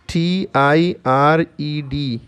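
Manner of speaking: slow letter-by-letter spelling. Shown as a demonstration. Teacher spells a new word for learners, slow spelling. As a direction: spell it slowly, each letter held apart.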